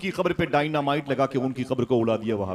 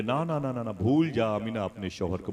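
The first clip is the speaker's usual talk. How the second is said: ordinary talk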